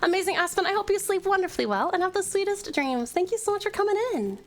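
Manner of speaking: high, affectionate send-off; sweet goodnight. Real, not acted. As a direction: coo a fond farewell to a friend. Sweet goodnight to a departing viewer.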